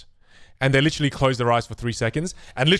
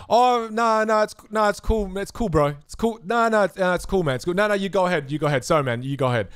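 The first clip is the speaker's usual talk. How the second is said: higher, flustered 'it's cool' brush-off; backing-down heckler; 6 semitones higher than usual. Retold or imitated. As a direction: play the embarrassed heckler refusing the cards.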